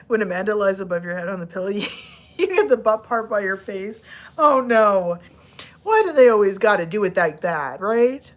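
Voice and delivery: ordinary talk, nothing marked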